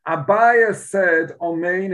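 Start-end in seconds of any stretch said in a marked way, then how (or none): none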